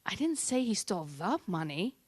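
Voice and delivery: "the" stressed as a full, separate word. Shown as a demonstration. Coach shows emphasis to an audience, stress on "the". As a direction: lean on "the", as if one particular sum. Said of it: "Certain emphasis on certain words".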